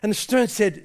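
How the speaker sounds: ordinary talk, nothing marked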